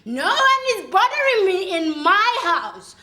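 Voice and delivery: put-on loud, drunken declaration; drunken defiance. Drinking alone at home, loudly defiant. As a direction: proclaim it at the top of your voice.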